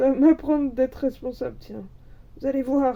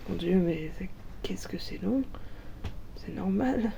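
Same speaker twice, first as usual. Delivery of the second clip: whispering; 4 semitones lower, 9 dB quieter than usual. Real, not acted. Impatient waiting for egg hatching.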